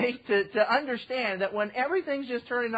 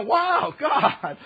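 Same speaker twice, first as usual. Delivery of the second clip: higher, louder wondering wow; awed wow; 9 semitones higher, 8 dB louder than usual. Retold or imitated. Believer amazed that God answered, awed wow. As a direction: marvel out loud.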